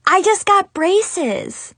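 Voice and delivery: high, drawn-out blurted confession; acted high-pitched announcement. A narrator's reading. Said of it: "and blurted out".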